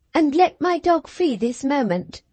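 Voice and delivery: put-on high, demanding girl; exclaimed order. A narrator's reading. Cathy demands her dog, high demanding girl. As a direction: insist on it now. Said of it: "she exclaimed".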